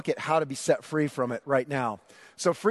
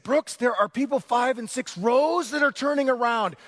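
higher, louder urgent rebuke; scolding wife at the game; 9 semitones higher, 5 dB louder than usual. Retold or imitated. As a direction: warn him that people are staring. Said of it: "And Stacy said".

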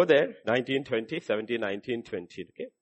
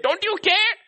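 much higher, louder whining cry; desperate plea; 5 dB louder than usual. Acted. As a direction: cry it out like a panicked disciple. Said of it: "that's what they said".

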